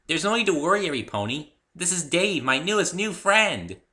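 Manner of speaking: high, loud, excited party pony voice; chirpy pony introducing her friend; bubbly pony. A narrator's reading. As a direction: announce a new friend to the crowd with glee. Pinkie addresses the crowd.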